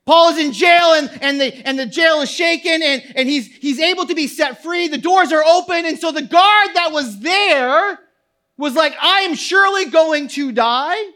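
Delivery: high, loud pulpit storytelling; full-force preaching. Real, not acted. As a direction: drive the story forward at a near shout.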